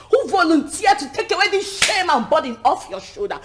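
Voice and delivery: indignant scolding. Acted. Scolds over refused suitor.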